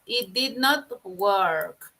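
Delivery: very slow, words set apart; slow model sentence. Shown as a demonstration. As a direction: dictate it clearly. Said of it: "Esto o aquello no trabajó".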